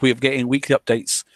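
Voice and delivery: usual speaking voice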